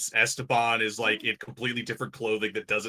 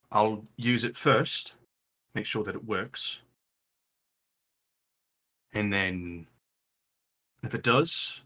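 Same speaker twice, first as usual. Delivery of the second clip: lightly voiced leader briefing the group; deliberate in-character delivery, long pauses between phrases; Raylan, measured and sober; 4 semitones lower than usual. Raylan thanks the party and lays out plans, measured and sober.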